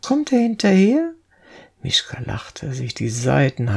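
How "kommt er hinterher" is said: high, raised worried question; anxious but smiling. A narrator's reading. Girl looks back for the pursuer, anxiously smiling. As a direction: ask with a nervous smile. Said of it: "ängstlich lächelnd".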